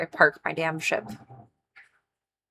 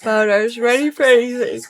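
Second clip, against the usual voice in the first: character voice; 5 semitones higher, 6 dB louder than usual. Pretend. Voicing her character Bowder.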